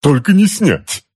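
high, slow, strained complaint; anguished wail. A narrator's reading. Uncle wails about the suit.

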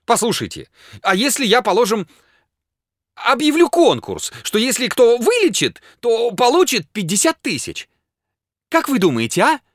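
high, excited desperate proposal; agitated, high-pitched pleading; frantic urgency. A narrator's reading. Frantic patient proposing a prize.

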